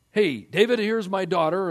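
high, loud, fake-friendly king; loud sly Saul offering his daughter; voicing Saul. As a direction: call out sweetly like a scheming king.

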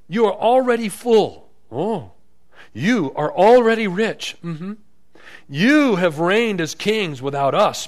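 loud, high mock admiration; sarcastic. Paul needling the self-satisfied church, loudly sarcastic. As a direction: heap on exaggerated, sarcastic praise. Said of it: "You hear his little sarcasm?".

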